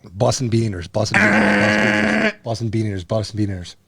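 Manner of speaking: low, slurred run of repeats; slurred tongue-twister. Shown as a demonstration. Failing the five-times-fast challenge, low drunken slur. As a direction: mumble through it like a drunk. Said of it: "That's too slurred".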